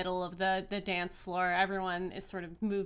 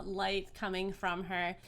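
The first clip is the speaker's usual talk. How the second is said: ordinary talk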